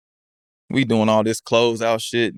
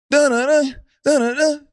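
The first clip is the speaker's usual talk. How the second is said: high sung dun-dun-dun sting; news jingle hum. Retold or imitated. Player imitating the TV news sting, high sung notes. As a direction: hum it like a breaking-news intro.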